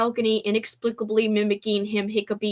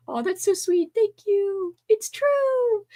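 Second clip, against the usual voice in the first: ordinary talk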